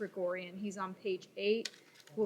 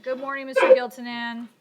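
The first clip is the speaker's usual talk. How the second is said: loud; 4 semitones higher, 15 dB louder than usual. Real, not acted. Judge calls out to defendant.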